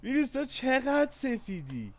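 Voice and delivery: high, slow, singsong taunt; playful jab at a friend who won; mock teasing. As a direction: drag the tease out like a playground chant. Teasing the winner Virus.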